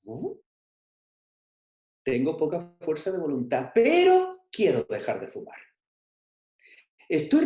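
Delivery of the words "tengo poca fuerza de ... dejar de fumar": "pero" raised high and loud to carry the goal; stress on pero. Shown as a demonstration. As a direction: push hard on "pero" to flip the sentence. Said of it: "Observa la pantalla". ("pero" alto y fuerte, marcando el objetivo)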